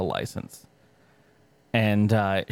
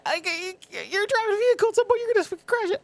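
exasperated; faster than usual. Real, not acted. Exasperated rant about drivers.

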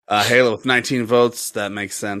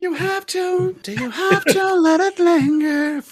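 mocking high voice. Mocking co-host's question back.

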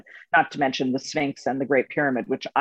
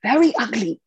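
imitating Ketut; 5 semitones higher than usual. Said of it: "And he would say".

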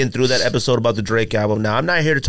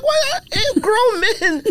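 mock outrage; much slower than usual. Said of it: "I'm like".

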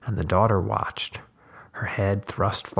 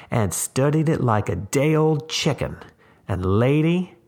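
raised, urgent storyteller tramp; intense tramp voice; 6 semitones higher, 4 dB louder than usual. A narrator's reading. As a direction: build it up high and pressing. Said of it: "he said".

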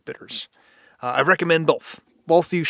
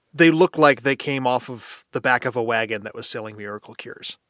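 ordinary talk, nothing marked

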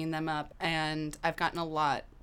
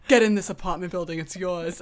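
hearty Northeastern welcome, louder; Northeastern landlord welcoming; 7 dB louder than usual. Retold or imitated. Landlord welcoming new tenants, hearty Northeastern accent. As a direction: boom it out in a generous Northeastern accent. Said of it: "Northeastern accent".

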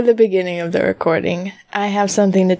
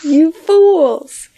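villain gloat, higher and louder; booming echoing villain monologue; 6 semitones higher, 4 dB louder than usual. Pretend. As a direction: boom it out like a cartoon villain. Mock villain taunt, echoed.

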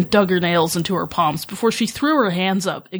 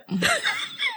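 high crying laugh; 8 dB quieter than usual. Real, not acted. Author about to read her fanfic aloud, whimpering laugh. Embarrassed to start reading.

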